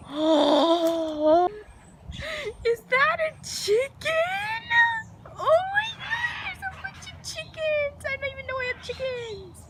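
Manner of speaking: very high, drawn-out squeal of amazement; gasp, then squeal sky-high in astonishment; amazed squeal. Real, not acted. Vlogger spots chickens in the backyard, squealing in amazement.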